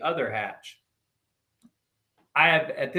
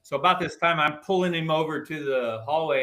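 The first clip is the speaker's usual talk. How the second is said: usual speaking voice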